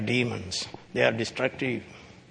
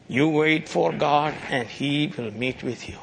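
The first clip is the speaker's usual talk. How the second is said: ordinary talk, nothing marked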